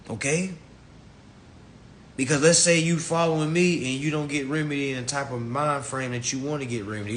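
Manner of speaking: normal talking voice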